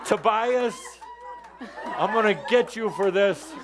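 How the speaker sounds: high-pitched playful grievance; mock threat. Teacher playfully blaming Tobias, mock threatening. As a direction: threaten in jest, pitched well up.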